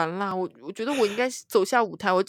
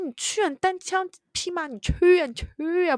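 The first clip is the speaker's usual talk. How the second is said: mock dare; 10 semitones higher, 3 dB louder than usual. Daring cohost to interview alone.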